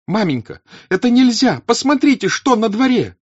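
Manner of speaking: very high, loud, outraged cry; Natasha shouting. Acted. Natasha bursting in on her mother, shouting. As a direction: hurl the words, outraged. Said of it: "закричала она".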